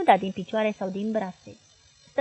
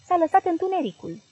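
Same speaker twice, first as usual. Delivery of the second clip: higher, louder frightened cry; girl cries out; 7 semitones higher, 4 dB louder than usual. A narrator's reading. Hypnotized girl shouts her terrifying vision aloud. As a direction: call it out into the dark. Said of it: "strigă fata".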